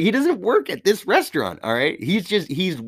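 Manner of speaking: high-pitched indignant retort; indignant protest. Retold or imitated. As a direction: argue back with the voice jumping up. Defending his friend against her accusation.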